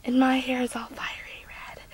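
soft, whispery aside; hushed aside. Acted. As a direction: drop to a near whisper. Aside after the shouted complaint.

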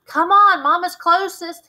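high, loud urgent call; urgent shout. A narrator's reading. Dale urging friend to race to Mama, high loud urgent call. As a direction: hurry them along loudly.

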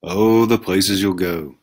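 ordinary delivery, nothing marked

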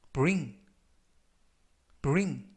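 "bring" said slowly and clearly, with a crisp b and a falling close; careful pronunciation demo. Shown as a demonstration. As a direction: model "bring" for learners, falling tune. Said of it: "이라고 발음하시면 되겠고요". ("bring" 또박또박 발음 시범)

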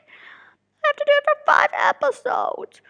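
high whiny fake sob; mock cry. Real, not acted. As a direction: whine about the workload. Complaining about workload.

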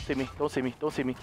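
hushed pleading. Real, not acted. Hiding from enemy, pleading.

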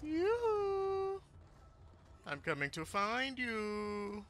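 high, slow sing-song stalker; creepy villain. Pretend. Villain to a hiding victim, creepy sing-song. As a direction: coo it like a killer playing hide and seek.

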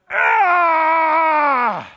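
high, loud frustrated scream. Retold or imitated. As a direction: let out a raw yell of frustration. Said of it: "he began his talk by screaming".

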